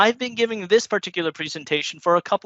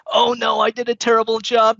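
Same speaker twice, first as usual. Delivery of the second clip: louder, higher mock dismay at bad feedback; mock panic; 4 semitones higher, 5 dB louder than usual. Presenter fretting over one bad review, in louder hammed-up dismay. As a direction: play the over-reacting presenter, half-joking.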